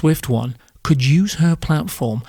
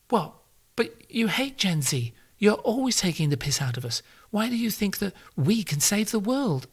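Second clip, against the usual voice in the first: put-on a softer, higher, put-upon young voice; whiny Gen Z listener; 6 semitones higher, 9 dB quieter than usual. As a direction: mimic a sulky Gen Z critic quietly. Imagined Gen Z listener objecting.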